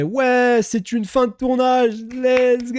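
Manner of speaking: high, drawn-out re-enacted cheer; retold wrap cheer. Streamer re-enacting the crew at the end of filming, cheering. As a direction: call it out like the crew celebrating. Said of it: "on a fait".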